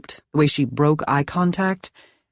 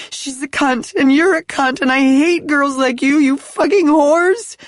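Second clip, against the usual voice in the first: put-on high, loud drunken rant; raging; 11 semitones higher, 5 dB louder than usual. Drunk wife cursing Kenzie through the door, furious shouting. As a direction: spit out every insult in fury.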